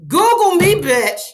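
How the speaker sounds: loud, high, brash catchphrase shout. Retold or imitated. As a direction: belt it out like a diva line. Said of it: "Google, O G".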